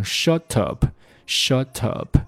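slow shut up, t tapped like a quick d; slow flapped shut up. Shown as a demonstration. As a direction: tap the t of shut into up. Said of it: "flap T".

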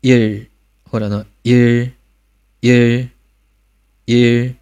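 一儿 with the tongue tip on the palate; final r coda. Shown as a demonstration. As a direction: clear 一儿 closing on the palate. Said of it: "一加收音". (一加收音)

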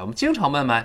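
usual speaking voice

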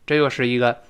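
usual speaking voice

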